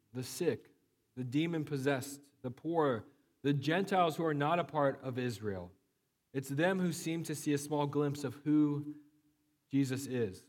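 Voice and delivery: normal talking voice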